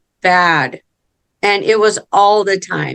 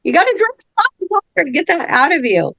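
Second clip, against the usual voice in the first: mock advice; 9 semitones higher than usual. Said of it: "I'm like".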